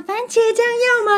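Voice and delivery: high, slow, chirpy mock of a cheerful vendor; sunny vendor. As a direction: drawl it out like an overly sunny shopkeeper. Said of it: "我就回想他那个脸这样". (用高而慢、装出来的阳光语气问)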